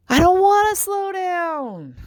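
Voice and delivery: high, slow, drawn-out whine; whiny protest. Retold or imitated. Mom whining back at her family, high and drawn out. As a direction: stretch it out like a stubborn kid.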